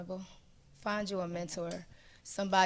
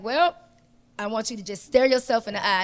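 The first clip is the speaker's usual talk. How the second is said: firm command; 2 semitones higher, 9 dB louder than usual. Retold or imitated. Friend firmly commanding.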